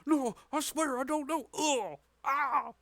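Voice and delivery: high, loud acted pleading under a beating; hammed-up cries of pain and panic; muffled yelling. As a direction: yell out like a roughed-up captive. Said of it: "you hear muffled yelling".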